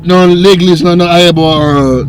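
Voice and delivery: put-on French babble to dodge the cop; fake French. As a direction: play dumb in broken French.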